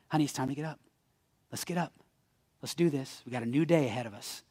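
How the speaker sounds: soft, low, gentle wake-up voice; tender love. Acted. Mom waking her daughter in the morning, tender. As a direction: coax a sleeping child awake softly. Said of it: "A tender love".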